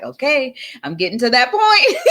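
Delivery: laughing at her own overwhelm; laughing self-quote. Retold or imitated. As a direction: admit it through a laugh. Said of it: "I'll say it like".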